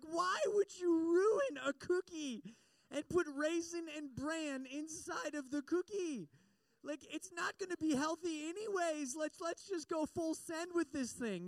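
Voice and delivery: put-on high, slow, indignant child's rant; mock outraged kid. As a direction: whine like a betrayed five-year-old who got duped. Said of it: "I was mad".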